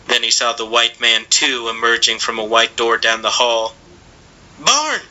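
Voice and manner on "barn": put-on high, frantic boy's shout; urgent cry. A narrator's reading. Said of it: "he cried".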